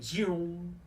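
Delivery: high, drawn-out zoom; zoom sound effect. Real, not acted. Gamer voicing the action on screen, zoom sound effect. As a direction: imitate something whooshing off, high-pitched.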